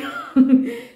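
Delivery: high delighted laugh. Real, not acted. She laughs with joy over her haul.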